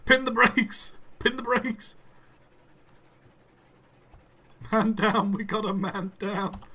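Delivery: loud high panicked yelling; man down shout. Real, not acted. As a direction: shout the alarm at the crash. Train crash in game.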